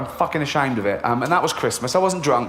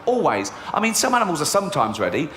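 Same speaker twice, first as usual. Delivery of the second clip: ordinary delivery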